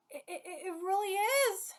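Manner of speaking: normal talking voice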